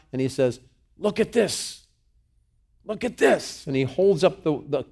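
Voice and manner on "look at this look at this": high-pitched insistent call; acted urgent cry; urgent appeal. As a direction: demand his attention, pitched high. Acting David displaying the robe.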